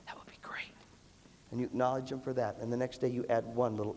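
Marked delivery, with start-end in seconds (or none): 0.1-0.7 hushed, patient encouragement; barely voiced coaxing; gentle whisper. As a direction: whisper it kindly to a child. Acted gentle coaxing of kid.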